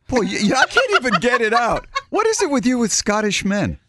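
high, fast teasing disbelief; teasing squeal. Real, not acted. Host teasing co-host about her Scottish crush, high squeal.

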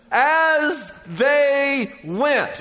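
very slow, every word stretched; slow emphatic reading. Shown as a demonstration. Preacher slowing right down on the key verse. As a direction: drag out each word to stress it. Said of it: "you ought to circle that".

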